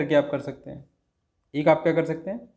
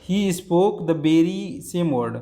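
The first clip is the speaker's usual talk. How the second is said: very same word I have spoken, said slowly; drawn out for the class; teacher slowing down on the example; slow emphatic demo; 3 semitones higher, much slower than usual. Shown as a demonstration. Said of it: "क्या कर लीजिए आप".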